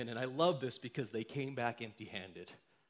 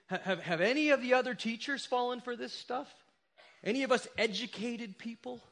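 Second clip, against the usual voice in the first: mocking professors; 8 semitones higher, 5 dB louder than usual.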